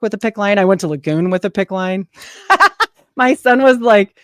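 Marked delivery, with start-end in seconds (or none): 2.5-4.2 laughing. Real, not acted. Laughing while retelling story.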